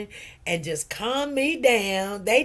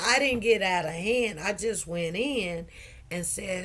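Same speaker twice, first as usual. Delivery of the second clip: ordinary talk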